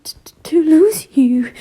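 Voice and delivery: tearful broken stutter; crying stutter. A narrator's reading. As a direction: stammer through tears. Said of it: "he cried".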